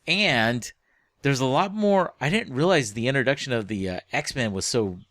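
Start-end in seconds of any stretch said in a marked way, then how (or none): none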